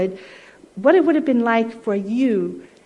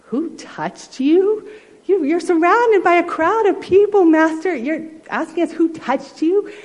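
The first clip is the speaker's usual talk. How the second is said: incredulous disciples; 8 semitones higher than usual. Acted. Disciples protest to Jesus amid the crowd, incredulous. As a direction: scoff at the question in amazement.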